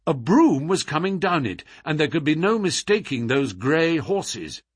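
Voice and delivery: ordinary delivery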